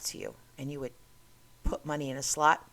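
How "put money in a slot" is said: ordinary delivery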